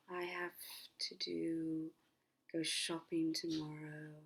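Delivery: soft, drowsy, spaced-out words; slow, pausing between words. Shown as a demonstration. Coach models a to-do list thought, slow with pauses. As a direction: let each phrase drift out slowly and softly. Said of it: "you pause between each words".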